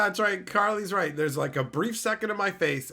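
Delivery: ordinary delivery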